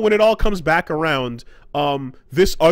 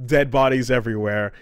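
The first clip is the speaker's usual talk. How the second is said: ordinary talk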